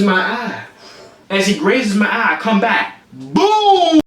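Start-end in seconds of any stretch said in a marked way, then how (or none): 3.1-4.0 high, long drawn-out "boom"; exaggerated high-pitched boom; punch impact noise. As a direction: voice the punch as a big sound effect. Imitates his punch landing.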